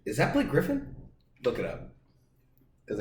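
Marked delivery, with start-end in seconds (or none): 0.1-0.9 high-pitched puzzled question; incredulous, much higher voice; mock double take. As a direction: pretend to be baffled, voice pitched way up. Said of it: "and be like".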